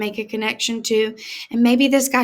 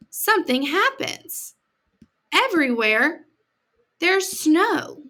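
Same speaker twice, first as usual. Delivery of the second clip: put-on reading a picture book to kids; gentle read-aloud for children; story reading; 3 semitones higher, slower than usual. A narrator's reading. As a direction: tell it like a storybook to a class.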